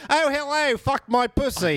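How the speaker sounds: high-pitched mock Australian woman; hammed-up, squeaky-high Australian come-on; Australian accent. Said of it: "Australian accent on a woman sounds ridiculous".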